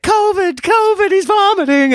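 very high-pitched hysterical crowd imitation; mock panic. Boarding passengers reacting to a puking kid, mock panic. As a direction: shriek the alarm in a squeaky falsetto.

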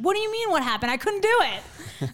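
loud, high-pitched exasperated outburst; snap reaction. Retold or imitated. Defeated athlete answers coach's "what happened", loud exasperated snap. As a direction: fire back indignantly, re-enacting the blow-up. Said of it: "And I literally snap reaction".

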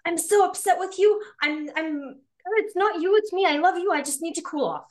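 high-pitched upset plea, owning the feeling; acted heated venting that still reassures; upset but responding. Shown as a demonstration. Upset partner asking for time to cool off, upset yet loving. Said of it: "both yelling, both upset".

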